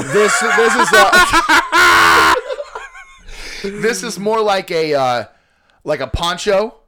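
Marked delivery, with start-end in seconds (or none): 0.0-2.3 laughing. Real, not acted. Laughing at robe mix-up.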